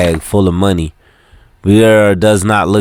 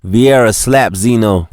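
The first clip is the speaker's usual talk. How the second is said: ordinary delivery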